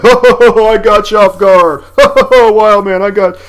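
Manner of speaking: high, loud coach voice cackling through the words; laughing hysterical coach. Retold or imitated. Big fat coach mocking Wildman, laughing loud and high. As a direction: play the big coach laughing his head off at a knocked-down player. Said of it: "laughing hysterically".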